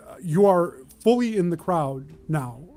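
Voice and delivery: normal talking voice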